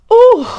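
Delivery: a sudden high, loud overwhelmed ooh; overwhelmed ooh in the middle of her family story; emotional high ooh. Real, not acted. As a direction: let a high, loud ooh burst out as feeling wells up. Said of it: "I can get emotional".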